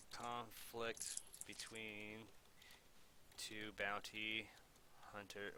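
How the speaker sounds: very slow, spaced-out dictation. Shown as a demonstration. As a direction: spell it out slowly for someone to type.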